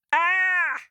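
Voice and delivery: high shrieking witch cry. A narrator's reading. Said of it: "shrieked Baba Yaga".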